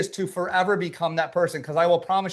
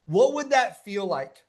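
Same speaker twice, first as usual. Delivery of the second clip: ordinary talk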